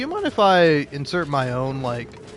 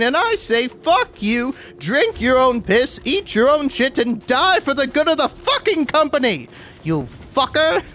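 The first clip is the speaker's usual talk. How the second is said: higher, hammed-up tirade; mock-furious boss; 8 semitones higher than usual. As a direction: berate the worker in comic outrage. Boss berates worker in bit.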